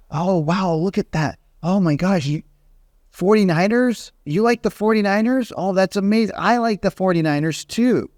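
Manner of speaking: high, gushing mock excitement over the helmet; fake enthusiasm. Agent gushing to a homeowner about the forty-niners, fake excitement. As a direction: overdo the delight like a salesy agent. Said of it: "you start talking about".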